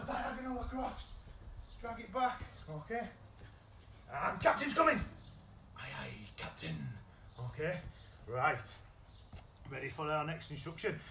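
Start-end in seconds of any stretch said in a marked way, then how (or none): 4.1-5.0 raised, higher call of the command; coach's game call. Real, not acted. Calling the command to the players. 5.8-6.9 soft whisper of the pirate's answer; a hushed crew reply. Acted. Answering the captain game call.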